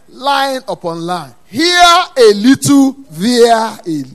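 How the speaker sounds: loud, slow, high pulpit proclamation; thundering call to the congregation; full-force scripture preaching. Real, not acted. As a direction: build each phrase into a drawn-out cry.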